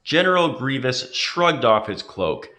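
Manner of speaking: plain reading voice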